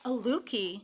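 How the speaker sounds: ordinary delivery, nothing marked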